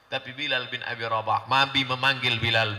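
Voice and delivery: ordinary talk, nothing marked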